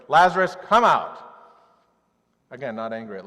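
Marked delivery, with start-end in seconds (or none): 0.1-1.2 loud, forceful call with flaring nostrils; Jesus calls Lazarus from the tomb in anger, shouting; fierce shout. Retold or imitated. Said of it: "still nostrils flaring, going".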